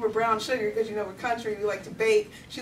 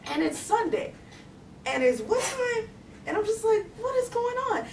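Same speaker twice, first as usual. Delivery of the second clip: mock bafflement; 11 semitones higher than usual. Girl wondering why pastor grandmother is home, baffled. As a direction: pitch climbing higher with each puzzled phrase.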